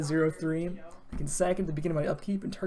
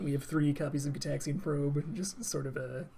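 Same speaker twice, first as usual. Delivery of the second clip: usual speaking voice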